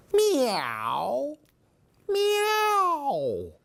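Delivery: rising high meows, second one very high; playful climbing cat meows; kitty meows. Acted. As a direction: meow twice like a happy kitten. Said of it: "said Lily".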